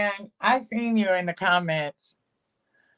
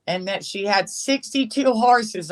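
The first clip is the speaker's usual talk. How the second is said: usual speaking voice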